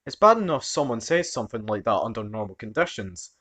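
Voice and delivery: ordinary delivery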